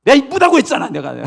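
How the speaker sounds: high, loud motherly telling-off; acted fed-up mother; exasperated scolding. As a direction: scold the child who won't listen. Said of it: "그리고 막 이제 혼냅니다".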